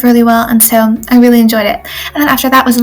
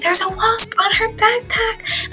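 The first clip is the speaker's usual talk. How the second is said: high, hushed and slow, like a scared warning; hushed alarm; 10 semitones higher, 8 dB quieter, slower than usual. Retold or imitated. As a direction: whisper it up high as if the wasp might hear. Retelling scared warning about wasp.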